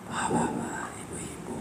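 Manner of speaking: breathy unvoiced whisper, wrong kind of gentle. Shown as a demonstration. Preacher showing the congregation wrong gentleness, whispered. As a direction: whisper it as a mock example of false softness.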